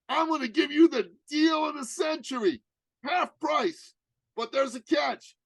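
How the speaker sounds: hammed-up hard sell on the phone; urgent sales pitch. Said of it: "With a lot of urgency".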